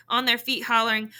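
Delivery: plain reading voice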